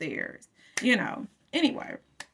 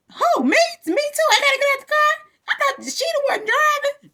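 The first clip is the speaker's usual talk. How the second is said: 1 shrill disbelief; 5 dB louder than usual. Retold or imitated. Friend ordered out of car.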